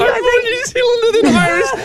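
put-on high, laughing New Zealand accent; thick Kiwi accent. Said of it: "Sit in the thickest Kiwi accent".

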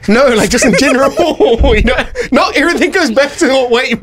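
laughing protest. Real, not acted. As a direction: object while trying not to crack up. Co-host laughs while reassuring him.

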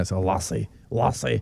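a soft, low Scottish "lassie"; quiet Scottish brogue on "lassie"; Scottish accent. Shown as a demonstration. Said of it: "Do Scotland".

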